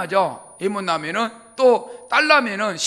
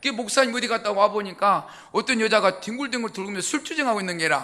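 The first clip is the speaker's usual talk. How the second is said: usual speaking voice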